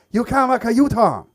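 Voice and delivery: loud, high angry instructor yell. Retold or imitated. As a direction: bark it like a furious driving instructor. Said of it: "and he's going".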